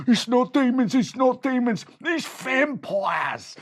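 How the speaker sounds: high loud panic, slowing on vampires; frightened bandit. Acted. Bandit corrects the others in high panic. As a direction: yell it, then drag out vampires. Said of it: "Bojangle Bob said".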